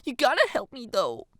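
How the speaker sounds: put-on high, whiny girlish plea; pleading. A narrator's reading. Girl biting lip to friend, pleading. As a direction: beg a favour in a high voice.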